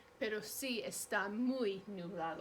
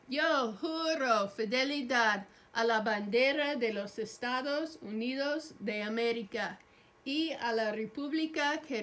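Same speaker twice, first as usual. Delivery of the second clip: louder, measured recitation; recited pledge; 6 dB louder than usual. Acted. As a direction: lead the class through the pledge. Leads pledge of allegiance.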